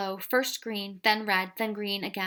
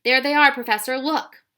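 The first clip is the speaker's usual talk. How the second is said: much louder, higher alarmed cry; cried out; 4 semitones higher, 11 dB louder than usual. A narrator's reading. Ben alerting the professor, an alarmed shout. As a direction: shout to point them out. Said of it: "Ben cried".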